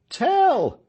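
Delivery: high, loud cheering shout; crowd shouting. A narrator's reading. The crowd shouting Tell's name in triumph, loud and high. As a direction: call his name out over the crowd. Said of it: "shouted the crowd".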